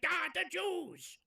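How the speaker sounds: high, slow cartoonish yelp; mock Ayatollah. Mocking the Ayatollah stubbing his toe, high cartoon yelp. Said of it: "and he's like".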